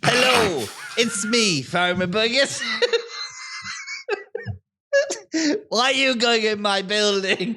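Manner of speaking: put-on a suspicious farmer in an Irish-sounding accent, breaking into laughter. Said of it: "I've got the accent".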